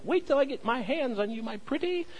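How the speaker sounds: lightly mock the Wicked Witch, rising high on my pretty. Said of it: "like the Wicked Witch of the West".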